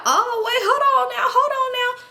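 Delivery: high, laughing flustered guy voice. Retold or imitated. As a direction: squeak it out like a cornered guy. Guy flustered as girl grinds.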